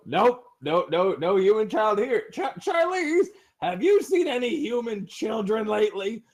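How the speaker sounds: shifty lizard guard. Acted. Guard nervously denies seeing any human child. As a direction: fake innocence, too eager.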